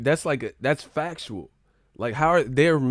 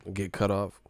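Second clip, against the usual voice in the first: normal talking voice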